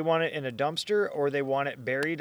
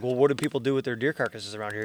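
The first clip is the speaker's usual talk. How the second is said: normal talking voice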